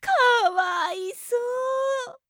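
high, sing-song fake sympathy; mock pity. Mistress pitying her masochist's size in high mock sympathy. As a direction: coo it like you don't mean it at all.

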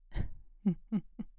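short whispered laugh at his reaction; amused scoff under the breath; breathy mocking chuckle.

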